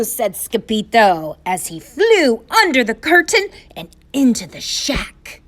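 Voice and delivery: plain reading voice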